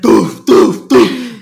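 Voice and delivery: loud, booming doof noises for the broken fan; imitated fan thud. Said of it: "it's like".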